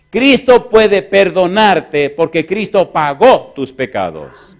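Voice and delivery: loud, slowed, deliberate pulpit declaration; slow emphatic preaching. Acted. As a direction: lean on every word.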